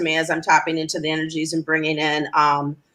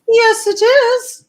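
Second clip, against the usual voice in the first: slow, high playful sing-song; 7 dB louder, much slower than usual. Pretend. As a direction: draw it out high and theatrical. Playful answer to closing question.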